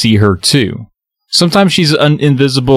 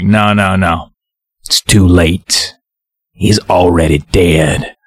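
lower, slow menacing villain; shadow villain; 5 semitones lower, slower than usual. Retold or imitated. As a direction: pronounce his doom with dark relish. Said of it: "he's like".

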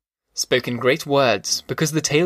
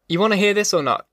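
quick, higher-pitched challenge; mildly testy, quicker question to a heckler; impatient challenge; 6 semitones higher, faster than usual. Acted. Storyteller to an interrupting listener, mildly impatient.

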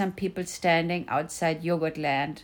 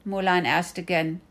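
ordinary delivery, nothing marked

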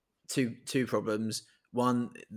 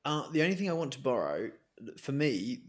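ordinary talk, nothing marked

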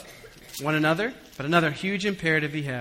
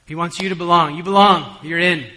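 preaching loud; 3 semitones higher, 11 dB louder than usual. Real, not acted. Preacher driving the point home.

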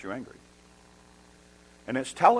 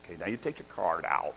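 low, softer mock of a cashier; frustrated cashier; 6 semitones lower, 11 dB quieter than usual. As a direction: imitate quietly strained patience. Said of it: "even the cashier's frustrated".

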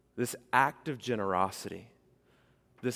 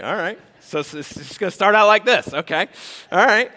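much higher, louder, caught off guard; hammed-up startled reaction; taken aback; 10 semitones higher, 14 dB louder than usual. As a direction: play it like you can't believe what you just heard. Acting out the stunned reply.

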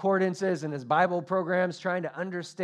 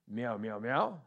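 low meows, the last rising; God speaking cat; 5 semitones lower, slower than usual. Acted. God answers the cat in cat language, low meowing. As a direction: answer in deeper cat meows, ending on a questioning lift. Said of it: "So God's like".

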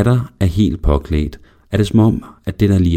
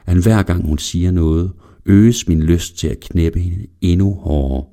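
plain reading voice

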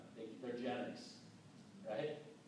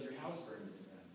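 hushed bad news; 13 semitones lower, 13 dB quieter than usual. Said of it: "He goes".

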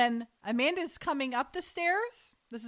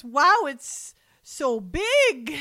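slow, louder, exaggerated mock wonder; mock wow; 4 semitones higher, 8 dB louder, much slower than usual. Mom faking delight at her son's gift, slow and drawn out. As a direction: stretch out fake amazement.

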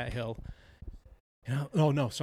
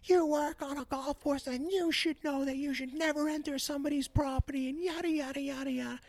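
mock shrill neighbour; 13 semitones higher than usual. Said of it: "And I said".

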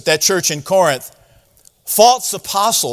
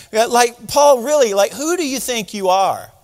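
high, incredulous mock scoff; scoffing challenge; 5 semitones higher than usual. Believers scoffing at Paul, high incredulous mock challenge. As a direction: sneer at Paul's claim to authority. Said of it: "the Corinthian believers saying".

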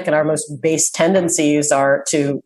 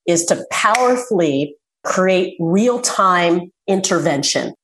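ordinary talk, nothing marked

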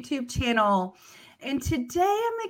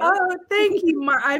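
pleased surprise; 9 semitones higher, 6 dB louder than usual. Real, not acted. Relieved at praise.

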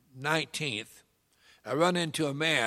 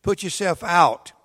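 preaching; 4 semitones higher, 6 dB louder than usual. Acted. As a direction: preach it to the congregation. Preacher addresses congregation.

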